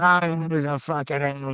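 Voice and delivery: lightly voiced angry Popeye; a hint of drunk, angry Popeye. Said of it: "And I said".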